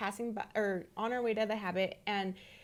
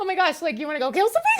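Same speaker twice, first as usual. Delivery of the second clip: laughing, shocked and louder; surprised; 6 semitones higher, 9 dB louder, much faster than usual. Retold or imitated. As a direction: gasp it out, half laughing. Shocked at son's hunting wish.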